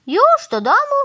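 very high, loud rising question; taken aback, pitch shooting up; surprised question. A narrator's reading. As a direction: ask in sudden surprise. Cow surprised that crow is leaving.

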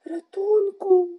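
high, drawn-out call for help; plaintive, loud, high cry; weak-voiced cry for help. A narrator's reading. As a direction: wail for rescue, stretching the word. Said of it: "zawołał słabym głosem".

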